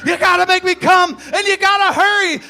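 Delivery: high, loud urgent yell; frantic shout. Retold or imitated. Poet voicing his lover demanding he hurry, shouting. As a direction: shout it like she can't wait. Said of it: "She says".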